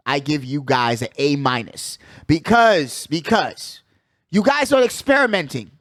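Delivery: usual speaking voice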